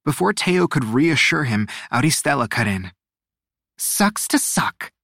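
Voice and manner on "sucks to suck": high, slow, smirking singsong; hushed, drawn-out gloat through a smile; sarcastic taunt. A narrator's reading. Aristela mocking out of Luna's earshot, sarcastic undertone. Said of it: "she said with a sarcastic smile".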